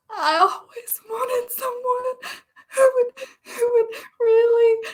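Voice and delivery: high, crying voice cracking through the words; breaking into tears. Acted. Winnie breaking down in tears to Daniel. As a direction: sob it out as the longing spills over.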